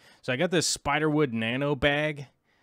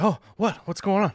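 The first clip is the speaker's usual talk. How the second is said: higher, louder startled "Oh, what?"; alarmed friend; 8 semitones higher, 5 dB louder than usual. Retold or imitated. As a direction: play the friend caught off guard, alarmed. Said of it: "And he'd be like".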